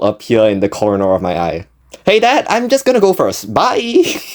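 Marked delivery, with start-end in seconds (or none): 2.0-4.2 very high, hurried-escape voice; mock panicked farewell. Son escaping the store clerk, mock panicked goodbye to dad. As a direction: call it out high, stretching the goodbye.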